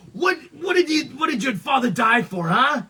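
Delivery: high, loud furious demand; angry outburst. Retold or imitated. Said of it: "really pissed off at Light".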